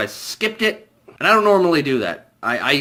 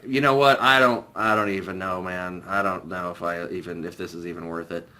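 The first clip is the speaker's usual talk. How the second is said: much softer and lower, giving up; defeated mutter; 9 semitones lower, 5 dB quieter than usual. Retold or imitated. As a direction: let it fade out, worn down by the movie. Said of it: "then I was like".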